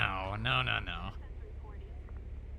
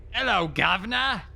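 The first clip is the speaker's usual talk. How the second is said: a hint of a jokey British greeting, higher and louder; mock British accent; 5 semitones higher, 5 dB louder than usual. Cop greeting partner in mock British accent. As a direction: toss off a Cockney hello. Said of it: "Do I gotta start talking like I'm British?".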